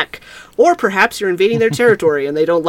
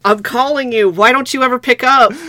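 higher, louder plaintive nagging; mock complaint; 6 semitones higher, 4 dB louder than usual. Creature scolding player who ignores calls, mock-hurt. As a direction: nag like a neglected caller.

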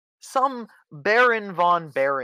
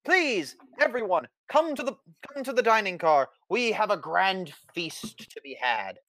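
pompous fussy courtier; 7 semitones higher than usual. Acted. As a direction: announce it grandly like a royal herald. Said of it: "Henry says".

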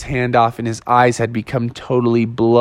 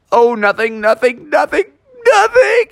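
rising high, faster nothings; nervous denial; 11 semitones higher than usual. Acted. Bubby hiding the mess from dad, high and nervous. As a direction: brush it off, squeakier each time. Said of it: "he's like".

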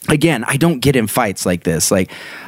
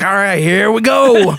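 higher, hammed-up tough bravado; fake tough guy; 8 semitones higher, 3 dB louder than usual. Backing up brother against kids, hammed-up tough talk. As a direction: pretend to be ready to brawl. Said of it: "act like I'm tough too".

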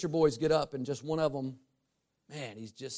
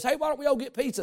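higher, brighter call that rallies the group; kid leader's call; 9 semitones higher, faster than usual. Retold or imitated. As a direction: pitch it up so everyone falls in behind you. Leader kid everyone follows.

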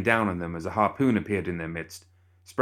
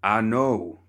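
louder holler; hollering back; 3 semitones higher, 4 dB louder than usual. Acted. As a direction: bellow it right back. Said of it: "Cornelius hollered right back".

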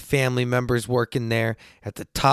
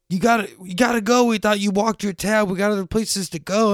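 urgent friends; 9 semitones higher than usual. Retold or imitated. Friends urging him to leave.

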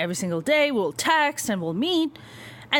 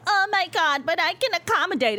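higher, sugary mock voice of a woman giving in; mock people-pleaser; 7 semitones higher than usual. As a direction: parody the eager accommodating girlfriend. Mocks women who give in.

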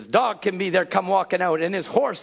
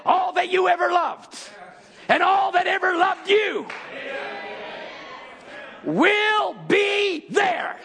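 high, shouted, slowing at the end; booming heavenly voice; 11 semitones higher, slower than usual. Acted. Preacher voicing heaven's promise, shouting. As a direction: proclaim it like a voice from heaven.

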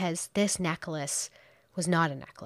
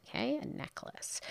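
whispered, raised in doubt; sceptical whisper; 8 semitones higher, 9 dB quieter than usual. Real, not acted. Host doubting the gift, sceptical whisper. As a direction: breathe it out in disbelief.

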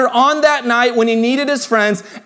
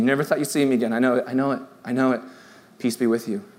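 soft, low, gentle reassurance; Jesus comforting; 10 semitones lower, 5 dB quieter, faster than usual. Acted. Jesus speaks gently to disciples.

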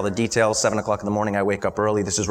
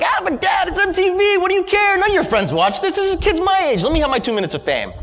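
put-on much higher, loud whining kid voice; whiny teen; 3 dB louder than usual. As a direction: beg like a bratty teenager pleading with dad. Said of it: "it might be like".

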